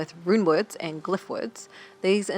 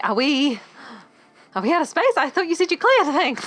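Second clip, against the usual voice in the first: higher, louder alarmed questioning; 9 semitones higher, 8 dB louder than usual. Retold or imitated. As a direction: sound shocked they're out of space. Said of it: "I was like".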